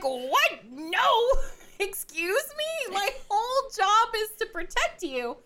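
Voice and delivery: a horrified knight, mock alarm; shocked outburst. Shocked knight in retold scene.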